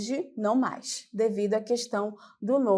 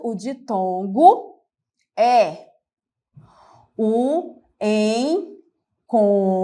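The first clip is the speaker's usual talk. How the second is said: very slow, loud, word by word; slow deliberate dictation; 4 dB louder, slower than usual. Shown as a demonstration. Teacher dictating the definition slowly and loudly. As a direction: spell it out slowly so students can note it.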